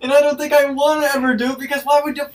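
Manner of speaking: high, loud, fast disbelief; shrieking disgust. Real, not acted. Reacting to her friend's toilet paper hack, shrieking in disgust.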